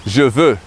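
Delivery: je veux, said zhuh vuh in careful French; French pronunciation. Shown as a demonstration. Teacher models je veux for English learners, careful French. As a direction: give je veux clean French vowels. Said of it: "I want" in French is".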